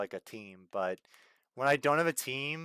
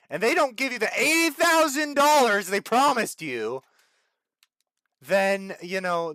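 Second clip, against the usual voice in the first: much higher and louder, whining; outraged whine; 8 semitones higher, 8 dB louder than usual. Real, not acted. Streamer complaining to viewers about unpaid reward, high whine. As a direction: squeal it out in disbelief. Ranting about stolen game pay.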